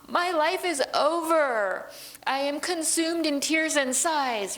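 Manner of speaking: high, slow, hammed-up tragic wail; melodramatic lament. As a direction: overplay the despair like an old libretto. Mocking overwrought old opera lines.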